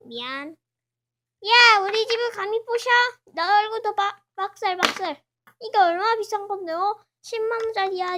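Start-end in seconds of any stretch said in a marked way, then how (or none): 1.5-8.2 loud, high furious telling-off; furious shout over the broken house; angry scolding. Acted. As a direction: yell at whoever smashed your house. Character scolds house breaker.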